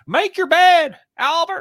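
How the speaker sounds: loud, very high mock scolding; high-pitched caricature, calling out; Jordan impression. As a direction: order it in a comically high put-on voice. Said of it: "sometimes he talks like this".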